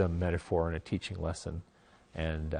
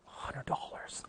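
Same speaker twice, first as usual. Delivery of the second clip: breathless whisper of disbelief; stunned whisper; 12 dB quieter, slower than usual. Retold or imitated. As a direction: whisper it like you can't believe the money. Stunned reaction to big offer.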